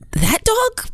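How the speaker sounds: high-pitched incredulous question; squeaky retold bewilderment; baffled disbelief. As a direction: imitate someone woken up and utterly confused. Grandson baffled when woken up.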